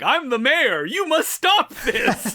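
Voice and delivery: high, slow, self-important official voice; pompous mayor. Retold or imitated. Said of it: "a character we've never seen before appears and he's like".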